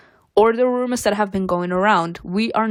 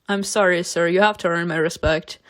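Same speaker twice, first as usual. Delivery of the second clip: ordinary talk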